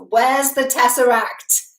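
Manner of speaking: loud, slow mock yell; retold yell. Coach retelling Thor yelling at Loki, a mild mock yell. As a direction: yell the question at a brother. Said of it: "he yells".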